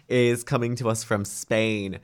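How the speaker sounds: ordinary talk, nothing marked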